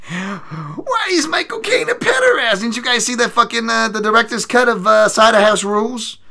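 very high, cracking crying shout; outraged shriek. Pretend. Mock-horrified at Michael Caine, shrieking very high.

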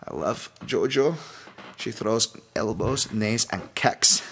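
slow, put-on Scottish accent. Fan praises his favourite fighter in imitation, slow Scottish accent. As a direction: draw it out in a playful Scottish accent. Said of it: "Little Scottish accent".

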